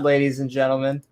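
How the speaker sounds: ordinary talk, nothing marked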